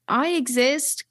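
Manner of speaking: high, pleading, slightly British character voice; a forgotten creature insisting it still exists; self-conscious extinct lizard. Pretend. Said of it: "give us a little tidbit of the voice".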